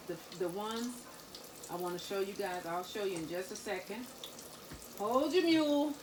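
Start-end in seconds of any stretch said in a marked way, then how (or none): 5.0-5.9 quoting a favorite TV cook, higher and lighter; lightly voiced Tabitha Brown quote; Tabitha Brown catchphrase. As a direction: toss off her catchphrase in a higher voice. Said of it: "as Tabitha Brown says".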